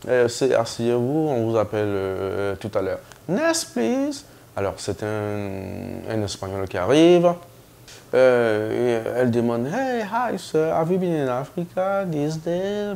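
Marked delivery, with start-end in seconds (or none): 3.3-4.2 high, chirpy call; cheerful nurse. Retold or imitated. Nurse calling the next patient, high and chirpy. 9.7-12.9 high, bright mock nurse; cheerful nurse. Nurse asking a Spanish patient about Africa, cheerfully high. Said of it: "Elle était toujours bien joyeuse".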